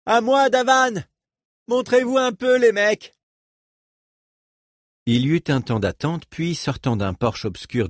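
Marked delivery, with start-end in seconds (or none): 0.1-3.2 appel crié, très aigu; voix élevée. A narrator's reading. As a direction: appelle tes gars de loin, à pleine voix. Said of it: "il éleva la voix". (Reich appelle les gars de Davan à grands cris)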